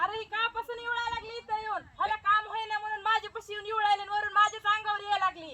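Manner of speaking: चढ्या आवाजातली कुरकुरी कामकरी बाई; high-pitched quarrelling village woman; भांडखोर बाई. Acted. (उंच आवाजात टोमणे मारणारी बाई)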